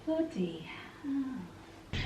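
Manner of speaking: slow, breathy whispered "bloody hell"; very slow whispered oath; whispered curse. Real, not acted. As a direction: hiss it under your breath at the bee. Startled by a big bumblebee.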